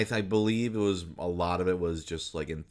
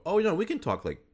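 higher, louder voice of dawning discovery; mock realization; 11 semitones higher, 4 dB louder, much faster than usual. Actors realizing they can drop stage speech, mock realization. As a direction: act out a sudden discovery, pitched up.

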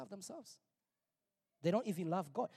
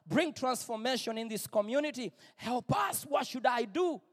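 loud, much higher anguished prayer; crying out to God; 6 semitones higher, 9 dB louder than usual. Retold or imitated. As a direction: cry out to God, exhausted and begging. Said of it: "I was literally crying to God".

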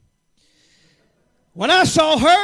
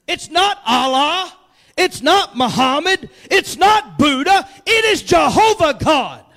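"not" hit on each line, building; emphatic list; 2 semitones higher, 7 dB louder than usual. Acted. Pastor naming the true God, building to loud. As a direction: climb through the list to the shout.

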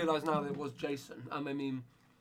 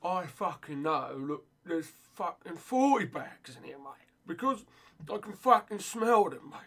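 louder, higher mock bent copper, menacing; aggressive cop voice; 4 semitones higher, 5 dB louder than usual. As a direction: ham up a swaggering dodgy policeman shaking down kids. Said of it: "This is Fabian in a police outfit".

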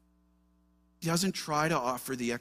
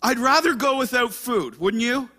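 1 doubting lament; 10 semitones higher, 11 dB louder than usual. Retold or imitated. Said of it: "where you're like".

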